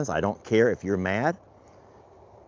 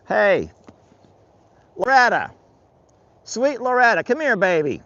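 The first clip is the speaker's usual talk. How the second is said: high, loud coaxing call; 13 semitones higher, 6 dB louder than usual. Real, not acted. Rancher calling sweet Loretta to come here, high holler. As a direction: holler sweetly to bring an animal over.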